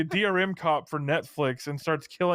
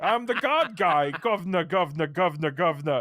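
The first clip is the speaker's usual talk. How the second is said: mock Cockney Milo. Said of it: "starts killing and farting and going".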